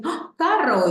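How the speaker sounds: high, loud "¡Ah, carro!"; small child spotting a car; excited child. Shown as a demonstration. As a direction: squeal like a delighted toddler. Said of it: "Te dice".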